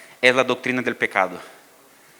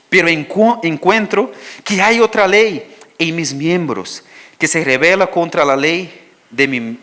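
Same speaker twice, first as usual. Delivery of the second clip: higher, louder, slow preached reading; fervent scripture reading; 3 semitones higher, 5 dB louder than usual. Acted. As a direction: proclaim Paul's struggle with force. Reads Romans aloud. (lectura bíblica ferviente, más alta y lenta)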